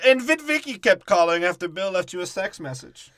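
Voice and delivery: high, loud caricature voice; mock taunting retort. Friend continuing the acted TV feud, high taunting caricature. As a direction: fling it out high and loud, hammed-up.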